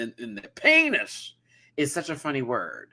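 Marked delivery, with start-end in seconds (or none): 0.5-1.3 "penis" said high as PAY-niss; playful, exaggerated high "penis"; comic pronunciation. Shown as a demonstration. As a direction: lean into the AY of "penis", pitched up. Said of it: "Like one of those in the".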